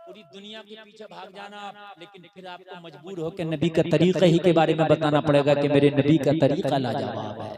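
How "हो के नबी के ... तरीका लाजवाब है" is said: lower, louder sermon voice; fiery preaching. Acted. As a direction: press the point on the crowd. Preacher at full force.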